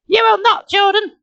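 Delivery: put-on very high girlish character voice; little sister. A narrator's reading. Nicole contradicting Jordan, high-voiced.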